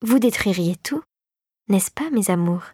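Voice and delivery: hushed, ending in a whisper; low voice. A narrator's reading. The fée dragée to the mice at the door, in a low voice. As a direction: drop into a whispered coo. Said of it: "reprit-elle à voix basse".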